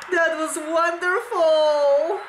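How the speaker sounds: ordinary talk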